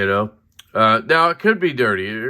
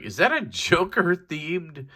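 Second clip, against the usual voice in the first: excited question; 6 semitones higher than usual. Retold or imitated. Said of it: "I was like".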